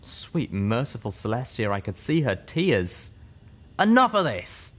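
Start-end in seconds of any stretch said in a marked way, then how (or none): 3.8-4.7 higher and louder angry growl. Acted. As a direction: cut it off hard. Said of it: "Calamity growled".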